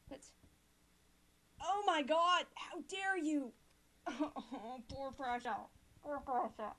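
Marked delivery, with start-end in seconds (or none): 1.6-3.5 louder, higher indignant cry; mock outrage. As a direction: act the outrage for fun. Reading Hungary line angrily.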